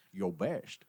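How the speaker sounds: horrible Scottish-accent impression; slushy Scottish "sh" for every "s", badly faked; Sean Connery. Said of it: "my horrible Sean Connery impression".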